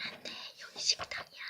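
soft whispered aside; whispering. Pretend. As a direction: whisper it so nobody hears. Doll play, secret aside.

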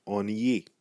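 "ennuyé" as "on-ee-yay"; Creole pronunciation. Shown as a demonstration. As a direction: open "ennuyé" on a nasal vowel. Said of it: "the way that we say bored is".

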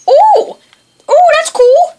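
high, loud "oh" of delight; amazed exclamation. Real, not acted. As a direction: burst out in awe. Sees new ability.

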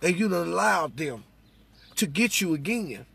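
ordinary talk

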